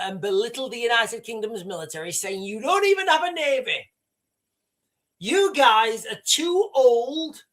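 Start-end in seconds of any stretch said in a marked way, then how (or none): 2.4-7.4 mocking quote. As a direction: sneer it out like a boastful leader. Quoting Trump belittling Britain's military.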